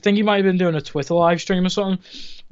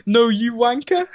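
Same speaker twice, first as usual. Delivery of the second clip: cheeky retort; 5 semitones higher, slower than usual. Retold or imitated. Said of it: "responds back with".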